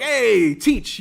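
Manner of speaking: loud, high hail across the hall; calling out. Retold or imitated. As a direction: call out to the teacher. Kids calling out to teacher.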